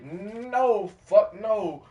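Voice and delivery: high, loud flat refusal; emphatic loud denial. Real, not acted. Denying knowing the stranger.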